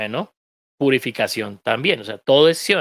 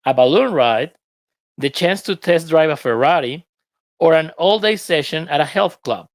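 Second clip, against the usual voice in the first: lively lesson read, a little louder; reading the text aloud; 3 semitones higher, 3 dB louder than usual. Acted. Teacher reads the lesson passage to students, lively. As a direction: read the passage to the class with energy.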